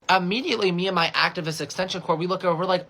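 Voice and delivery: normal talking voice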